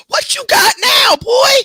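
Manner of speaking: high, loud cry of alarm; startled shout. Real, not acted. Owner shouting at the dog in the bed, a loud startled cry. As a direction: yelp it out, caught off guard.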